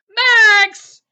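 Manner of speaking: loud high shout of a name; sharp calling shout. Acted. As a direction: call after him as he runs. Calling after Max running off.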